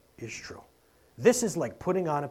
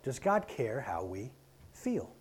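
ordinary talk

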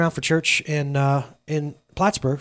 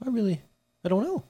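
soft, higher, hesitant voice; mock timid reply; 5 semitones higher, 6 dB quieter than usual. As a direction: imitate someone reluctant to explain. Retold upset girl answering reluctantly.

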